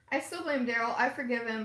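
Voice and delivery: ordinary talk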